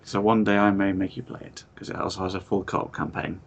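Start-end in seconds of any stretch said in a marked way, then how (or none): none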